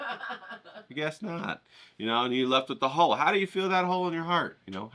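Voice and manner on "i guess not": laughing. Real, not acted. After joke, laughing.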